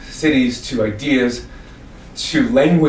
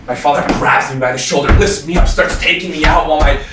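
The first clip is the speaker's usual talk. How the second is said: building frantic narration; 6 semitones higher, 6 dB louder than usual. Acted. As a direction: escalate to a loud, high climax. Being hauled out amid family chaos.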